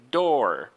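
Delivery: door drawn out with a strong final R; emphatic R. Shown as a demonstration. Teacher correcting the weak version, door with emphatic R. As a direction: grunt the R at the end of door. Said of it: "It's gotta be very emphatic".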